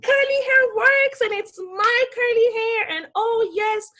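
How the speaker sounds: high, loud self-celebration; exultant. Retold or imitated. Woman revels in her new curly hair, exultant. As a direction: crow it with sheer delight. Retells feeling hot on the train.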